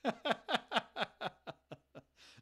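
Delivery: loud, high burst of laughter. Real, not acted. Streamer laughing at a viewer who screamed no, high loud laugh. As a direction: crack up at the chat.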